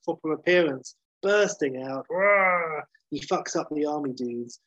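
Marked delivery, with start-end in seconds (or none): 2.1-2.9 mock Hulk roar, drawn out; imitated monster roar. Recapper acts the Hulk bursting out, mock roar. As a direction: roar like a monster, higher than usual voice.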